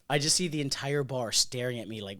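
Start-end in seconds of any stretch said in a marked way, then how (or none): none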